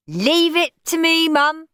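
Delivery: put-on high-pitched child taking charge; assured, high boy voice; confident boy. A narrator's reading. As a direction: reassure mum like a cocky little boy. Henry confidently taking over.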